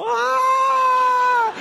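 long, loud, high-pitched shriek; hammed-up girlish scream of terror. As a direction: shriek at full volume in falsetto. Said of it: "I'm screaming like a girl".

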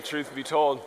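ordinary talk, nothing marked

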